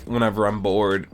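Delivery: ordinary talk, nothing marked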